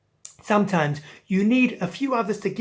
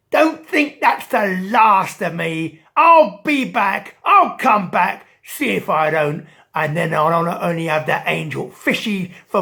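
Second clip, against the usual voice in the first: shark calling out threats; 8 dB louder than usual. Acted. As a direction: bellow it while swimming away. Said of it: "calling out".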